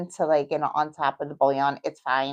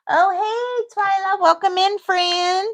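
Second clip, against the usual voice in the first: high, drawn-out Southern hello; big Southern welcome, pitched high and sung out; Southern-sounding greeting; 13 semitones higher, 5 dB louder, slower than usual. Real, not acted. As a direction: greet a friend loudly in a Southern lilt. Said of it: "Did I sound Southern there?".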